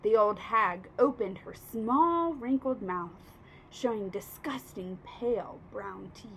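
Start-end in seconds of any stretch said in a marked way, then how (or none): none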